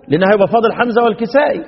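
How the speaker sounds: ordinary talk, nothing marked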